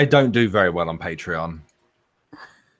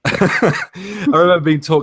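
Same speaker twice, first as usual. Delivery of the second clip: laughing; 9 semitones higher, 5 dB louder, slower than usual. Real, not acted. Laughing while starting anecdote.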